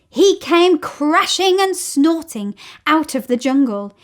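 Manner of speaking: usual speaking voice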